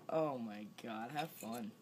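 ordinary talk, nothing marked

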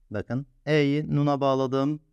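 ordinary delivery

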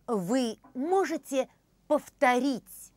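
ordinary delivery

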